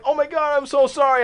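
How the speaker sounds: high-pitched mock apology; apologetic girl. Girl who spilled the icy apologizing, high imitated fluster. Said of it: "she's still like".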